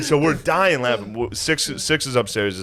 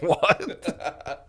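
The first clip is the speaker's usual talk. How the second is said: high, laughing "what"; mock disbelief; 7 semitones higher, 4 dB quieter than usual. Hearing Wood's worry, a high stunned "what". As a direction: act stunned and amused. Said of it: "I was like".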